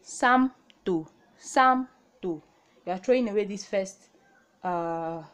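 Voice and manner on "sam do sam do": sam do spoken clearly; Korean reading. Shown as a demonstration. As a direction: model sam do. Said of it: "this will be sam do".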